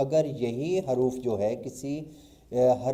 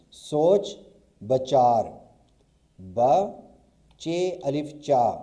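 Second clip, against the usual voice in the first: louder, slow, letter by letter: ب, چے الف چا; slow spelling out; 4 dB louder, much slower than usual. Shown as a demonstration. Teacher to students, spelling سوچ بچار slowly. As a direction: sound out each letter as you write. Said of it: "اب دیکھیں".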